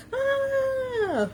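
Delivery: high, drawn-out mock scream; playful scream. Host leading viewers in a scream, playful scream. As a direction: let out a long playful squeal. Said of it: "everybody scream".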